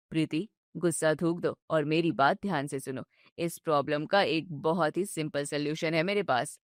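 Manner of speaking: calm her down patiently; soothing husband. Acted. Husband soothes his upset wife, low and gentle.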